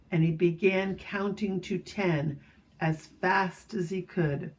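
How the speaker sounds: ordinary delivery, nothing marked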